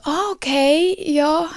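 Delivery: high, doubtful "ah, okay"; unconvinced "okay". Retold or imitated. Staff politely unconvinced by her answer, high and doubtful. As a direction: staff who don't believe a word of it. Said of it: "Und dann sind sie so".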